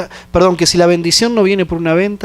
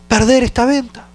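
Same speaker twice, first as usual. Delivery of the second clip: más agudo, quejándose ante Dios; súplica desesperada; 6 semitones higher than usual. Retold or imitated. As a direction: ruega a Dios con angustia contenida. (súplica angustiada, aguda)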